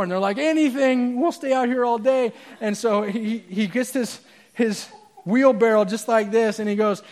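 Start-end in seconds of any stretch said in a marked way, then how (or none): 0.4-2.3 high-pitched, gung-ho fans; raised voice, up for anything; eager crowd. Acted. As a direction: agree eagerly for the whole crowd.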